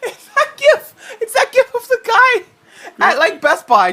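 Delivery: high-pitched, squealing laughter through the words; cracking up. Real, not acted. Host cracking up at co-host's hacker joke, laughing hard. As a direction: barely get it out for laughing.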